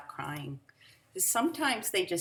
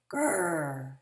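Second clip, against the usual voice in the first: growl; 4 dB louder than usual. Pretend. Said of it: "What the dogs and cats are saying".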